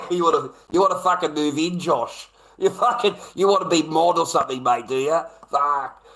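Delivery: loud delighted teasing of a fan; excited teasing shout. Real, not acted. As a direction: yell it at him, half laughing. Teasing a chatter, laughing, loud.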